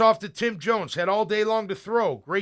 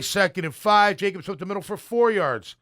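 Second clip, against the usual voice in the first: ordinary talk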